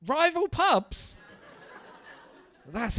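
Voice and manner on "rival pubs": slow, high, delighted disbelief; amazed. Real, not acted. Comic finds rival pub owners in crowd, slow amazed. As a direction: stretch it out in mock amazement.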